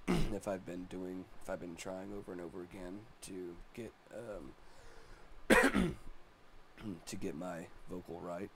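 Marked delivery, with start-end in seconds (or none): 4.1-7.0 real throat clearing mid-sentence; coughing fit. Real, not acted. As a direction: stop to clear a rough throat. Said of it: "Sorry, I'm coughing so much".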